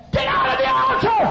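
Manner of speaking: loud, high-pitched bellow; angry shouted order. Retold or imitated. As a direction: roar at them to clear out. Pastor chases worshippers from altar.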